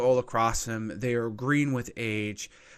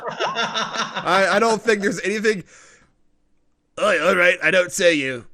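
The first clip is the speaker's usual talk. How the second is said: loud, raised gnome voice; persuaded gnome; 5 semitones higher, 8 dB louder than usual. Acted. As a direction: give in loudly as the fooled gnome. Gnome falls for the mind trick.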